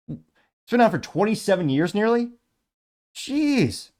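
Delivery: high, loud, drawn-out disbelief; astonished. Real, not acted. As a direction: react in amazement, pitch shooting up. Learns how long the show ran.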